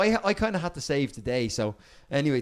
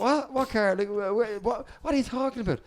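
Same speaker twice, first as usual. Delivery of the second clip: confused surprise; 8 semitones higher than usual. Retold or imitated. His confused reply at the door.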